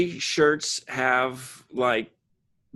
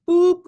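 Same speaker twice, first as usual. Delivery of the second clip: high tractor-beam boop; high, loud electronic boop; 8 dB louder than usual. Retold or imitated. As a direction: squeak it like a sci-fi beam. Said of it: "tractor beam sound effect".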